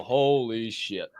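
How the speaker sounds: ordinary talk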